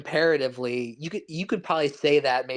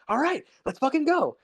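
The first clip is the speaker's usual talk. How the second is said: much higher-pitched imitation of manic hype; super manic; 11 semitones higher than usual. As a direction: act out a manic rush to get going, pitched way up. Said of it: "he's, like, super manic".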